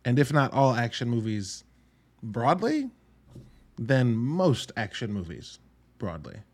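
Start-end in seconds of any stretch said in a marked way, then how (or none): none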